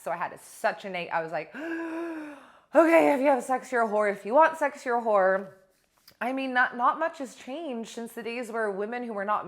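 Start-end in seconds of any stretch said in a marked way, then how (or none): 1.6-2.4 shocked gasp. Retold or imitated. Imitating shocked childhood self. 2.7-5.5 high, loud, scandalised voice; young self repeating what she was told, scandalised; alarmed declaration. Retold or imitated. Retelling her childhood belief.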